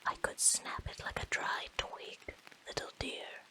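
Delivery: ordinary talk, nothing marked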